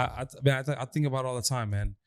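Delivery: normal talking voice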